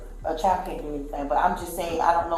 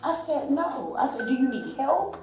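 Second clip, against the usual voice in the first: retold firm pleading, higher pitched; exasperated refusal; 6 semitones higher than usual. Witness refusing mother and offering help, exasperated. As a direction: replay your exasperated attempt to get through.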